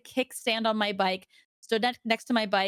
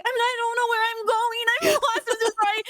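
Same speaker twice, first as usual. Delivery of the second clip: very high, loud mock wail; retold crying; 13 semitones higher, 4 dB louder than usual. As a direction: wail it like a hammed-up sobbing driver. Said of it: "I cried".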